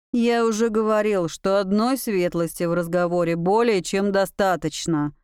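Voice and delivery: ordinary delivery, nothing marked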